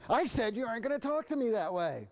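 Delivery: very high, exasperated scolding; hammed-up parent losing control of the argument; escalating parental outrage. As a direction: insist on it, voice pushed way up. Parent repeats scolding, quarrel escalates.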